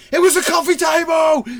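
very high, loud shout; full-voiced retold scream; screamed up the stairs. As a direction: yell it so upstairs can hear. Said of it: "screams up the stairs".

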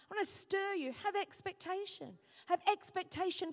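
full-force preaching. Acted. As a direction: stir the church with conviction.

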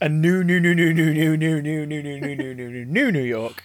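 loud start, sliding steadily down to the last word; comic rapid repetition. Pretend. Fan reeling off an absurdly long place name, comic rapid-fire repetition. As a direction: rattle off the repeated word in one long run.

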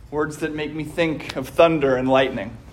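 ordinary talk

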